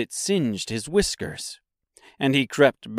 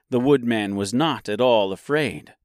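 ordinary delivery

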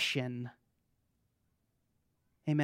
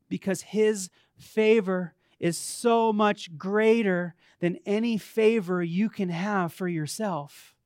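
usual speaking voice

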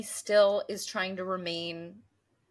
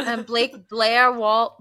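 American accent; 10 dB louder, slower than usual. Shown as a demonstration. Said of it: "why can't I say Blair Waldorf in an American accent".

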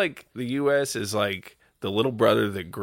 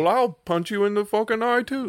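mock British; 10 semitones higher than usual. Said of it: "That's what they're doing".

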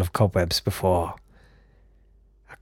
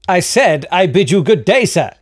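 higher, louder, pompous repeat; insistent haughty farewell; 10 semitones higher, 6 dB louder than usual. Acted. Traveller dismissing the barkeep again, higher louder pompous insistence. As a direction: insist on leaving, pompously, rising to the end.

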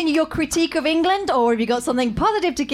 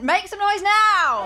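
drawn-out, higher call to the crowd; rallying call; 6 semitones higher, slower than usual. Real, not acted. Host asking the audience to vote by cheering, rallying call. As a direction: rouse the audience, stretching the words.

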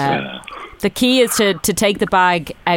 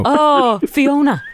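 lightly voiced Scottish ogre, pitched higher; Shrek; 8 semitones higher, much slower than usual. Put on the spot to say what Shrek would, light ogre impression. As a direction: have a go at a cartoon ogre's call. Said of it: "What would Shrek say?".

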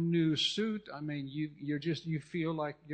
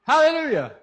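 sudden loud, high praise shout; exultant shout; 11 semitones higher, 15 dB louder than usual. Real, not acted. Preacher breaking into praise mid-sermon, exultant shout. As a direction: burst out in a preacher's cry.

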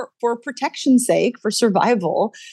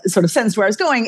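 ordinary talk, nothing marked

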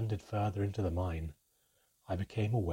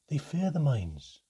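slow, higher hopeful plea; mild pleading; 6 semitones higher, 6 dB louder, slower than usual. A narrator's reading. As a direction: Brother Desant reassuring himself, mild pleading. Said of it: "His voice had a faint pleading note to it".

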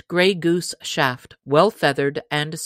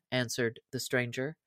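plain reading voice

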